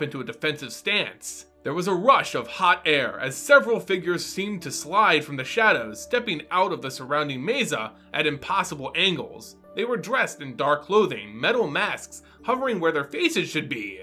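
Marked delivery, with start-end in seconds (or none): none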